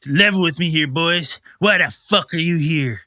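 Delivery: a hint of a slurring drunk; lightly voiced drunk. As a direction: lean in like a tipsy local, lightly. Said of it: "He goes".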